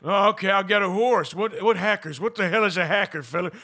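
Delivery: gruff cowboy talk from the frontier; Arthur Morgan. Acted. Held cowboy role.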